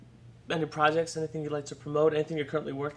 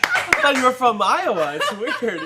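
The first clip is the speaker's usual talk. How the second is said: loud, higher joke through laughter; laughing; 8 semitones higher, 9 dB louder than usual. Real, not acted. Host joking about guest's American accent, laughing. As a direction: tease her while laughing.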